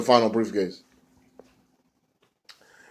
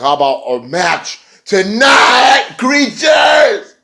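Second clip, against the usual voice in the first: slow, much higher shouted challenge; mock challenge shout; 10 semitones higher, 6 dB louder, much slower than usual. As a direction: bellow the dare like a ring promo. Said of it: "Jeff Hardy's like".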